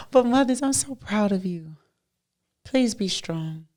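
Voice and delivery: normal talking voice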